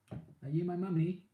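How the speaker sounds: put-on high, slow eerie child voice; creepy childlike quote. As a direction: mimic a creepy little kid asking. Quoting the episode's mummy line.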